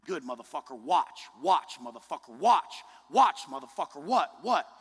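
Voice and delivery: loud chanted taunt, building; loud self-psyching taunt; hyped taunting. Retold or imitated. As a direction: pump yourself up, yell watch. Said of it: "strengthen my mind and say".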